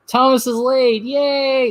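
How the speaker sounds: high mock-gleeful cheer. Commentator voicing engines happy Thomas is late, mock cheering.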